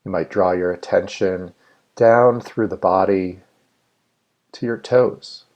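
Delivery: measured meditation guide with long, spacious pauses; guided meditation. Real, not acted. Meditation leader inviting listeners to settle and let go, guided meditation. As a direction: lead listeners unhurriedly, phrase by phrase, leaving room to breathe.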